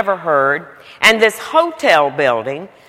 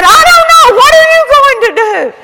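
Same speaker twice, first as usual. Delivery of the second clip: mock panic; 8 dB louder, faster than usual. Woman answers in panic.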